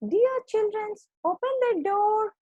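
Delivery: very high, coaxing fake sheep voice; wolf imitating mother sheep. A narrator's reading. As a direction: practise a sweet falsetto sheep voice. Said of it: "speak softly".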